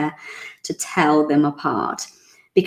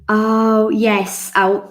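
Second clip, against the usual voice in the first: normal talking voice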